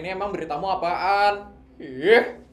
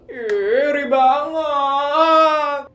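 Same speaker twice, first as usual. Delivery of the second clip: drawn-out, higher whine of envy; whiny envy; 7 semitones higher, 6 dB louder, much slower than usual. Acted. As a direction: stretch it out like a jealous pout. Friend jealous of engagement.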